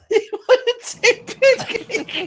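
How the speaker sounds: high, slow words through helpless laughter; laughing hard. Real, not acted. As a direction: barely get it out while laughing. Laughing while repeating.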